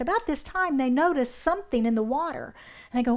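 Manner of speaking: usual speaking voice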